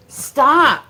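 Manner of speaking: high, very loud command; real exasperated shout; sharp scolding. Real, not acted. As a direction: shout it at a misbehaving dog. Scolding the dog.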